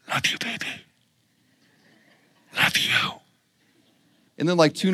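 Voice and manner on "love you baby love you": soft whispered phone voice; breathy whisper into the phone. Retold or imitated. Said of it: "I would hear him whispering on the phone".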